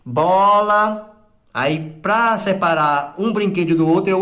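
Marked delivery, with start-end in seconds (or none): 0.0-1.1 "Bola." said high and loud; emphatic list item. Shown as a demonstration. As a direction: call out the first toy. (item da lista bem destacado)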